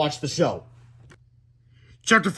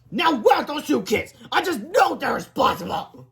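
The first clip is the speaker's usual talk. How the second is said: angry principal; 11 semitones higher, 4 dB louder than usual. Acted. Angry principal hunting kids.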